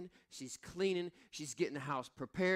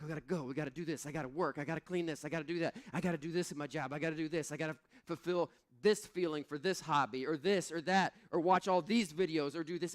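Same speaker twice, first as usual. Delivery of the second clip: rattled off fast; rushed busy list; faster than usual. Retold or imitated. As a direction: reel off the to-do list in a rush. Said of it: "We're like".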